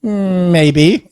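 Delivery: high, drawn-out hum into a teasing answer; coy pixie. Acted. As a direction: play a little pixie being cagey. Pixie coyly answers the player.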